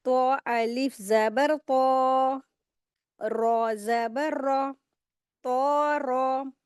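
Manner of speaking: very slow, heavy drawn-out syllables; slow heavy recitation. Shown as a demonstration. Teacher to class, spelling a heavy letter: slow and weighty. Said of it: "रौ को हम heavy करेंगे".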